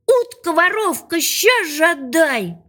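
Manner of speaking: high-pitched cry without real volume; storyteller's restrained version of a boy yelling; mock full-throated yell. A narrator's reading. Boy demands his fish back from the duck, mock yelling. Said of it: "Крикнул мальчик во всё горло".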